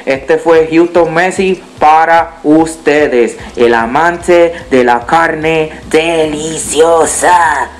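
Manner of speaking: slow, drawn-out outro catchphrase; stretched sign-off. Acted. Youtuber signing off his video with his catchphrase. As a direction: savour each word of the tagline.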